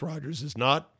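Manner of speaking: normal talking voice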